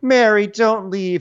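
slow, high mock plea; mock pleading. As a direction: beg her to stay. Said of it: "Jen's like".

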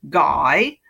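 GUY starting low with a questioning lift; interrogative tone. Shown as a demonstration. Guest demonstrating the first word, interrogative tone. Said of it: "It's an interrogative".

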